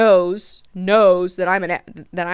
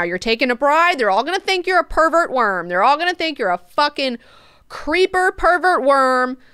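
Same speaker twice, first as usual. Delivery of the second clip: higher, louder angry rant; furious; 6 semitones higher, 5 dB louder than usual. Acted. Moneo furious at Leto over the betrothal, ranting loudly. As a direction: rage at him about the scandal. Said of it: "He is fucking furious. He is pissed".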